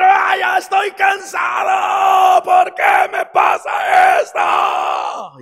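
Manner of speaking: high, slow, loud exhausted outcry; anguished shout of fed-up despair; frustrated yelling. Acted. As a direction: scream out your exhaustion to the sky. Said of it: "encontrar un lugar y gritar".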